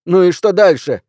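loud, high challenge; shouting. A narrator's reading. Suspect challenging the police, shouting high and loud. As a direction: shout it back at them. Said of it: "кричу я".

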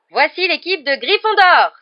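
high, loud match commentator's shout; crying out. A narrator's reading. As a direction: announce the team to a roaring stadium. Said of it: "s'écria Lee Jordan". (cri de commentateur sportif)

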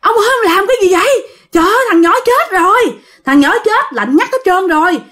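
high-pitched, frantic crowd protest; alarmed villagers' outcry. Acted. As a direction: cry out in alarm as horrified onlookers. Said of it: "mọi người la quá trời".

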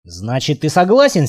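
ordinary delivery

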